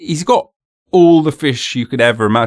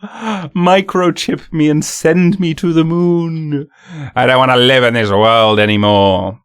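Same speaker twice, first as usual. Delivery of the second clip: mock despair; 5 semitones higher, 3 dB louder than usual. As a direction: beg like the world is ending, tongue in cheek. Gasp, pleading to Bill Gates.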